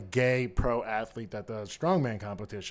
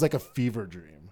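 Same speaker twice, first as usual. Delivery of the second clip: ordinary talk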